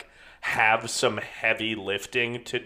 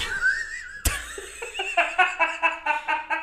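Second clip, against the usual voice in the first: high squealing laugh. Real, not acted. Laughing at own accent bit.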